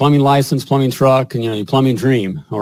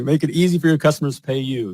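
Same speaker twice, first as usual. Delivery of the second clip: usual speaking voice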